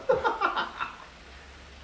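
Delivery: high cackling laugh; big high-pitched giggle; real laugh. Real, not acted. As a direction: burst out laughing at yourself. Laughs at own singing.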